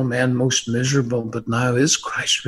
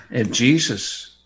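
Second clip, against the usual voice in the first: ordinary delivery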